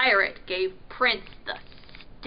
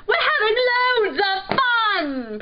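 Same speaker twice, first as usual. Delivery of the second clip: halloo it across the water; 9 semitones higher, 7 dB louder than usual. A narrator's reading. Prince calling to the dogfish, hallooing. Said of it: "Prince hallooed".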